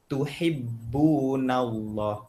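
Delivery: "Tuhibbunallah" stretched out slowly syllable by syllable; slow recitation. Shown as a demonstration. Teacher slows the verse for students, drawn out. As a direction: draw out each syllable for the class. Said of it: "Contohnya dalam Quran apa?".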